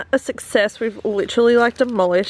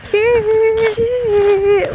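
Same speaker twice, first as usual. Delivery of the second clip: high squeal; 11 semitones higher, 5 dB louder than usual. Real, not acted. Cooing over cute thing.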